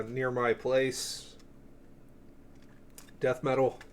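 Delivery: ordinary talk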